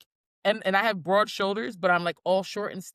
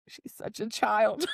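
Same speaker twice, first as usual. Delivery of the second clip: mock dry coworker; slower than usual. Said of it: "he's like".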